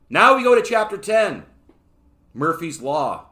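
high, booming chapter announcement; loud announcement. Real, not acted. Host moving on to chapter ten, loud announcement. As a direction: call it out big, well above chat level.

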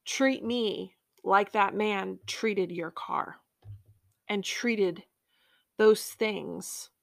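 slow, measured words with long pauses; a gentle slowing, leaving space between phrases; Holy Spirit speaking. Retold or imitated. Holy Spirit puts a question to a hurt believer, slow and solemn. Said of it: "the Holy Spirit, really say to me".

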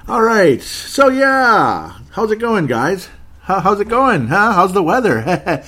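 loud, high, overdone chattiness; change the subject with forced, booming cheer; mock-cheery small talk. Feigns small talk after the blunder.

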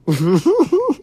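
high-pitched giggle. Real, not acted. Cohost amused by the guest's joke, high giggle.

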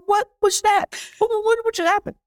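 high, baffled what-was-that; stunned disbelief. Retold or imitated. Retelling his baffled reaction at the restaurant, high and stunned. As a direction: squeak out in shock.

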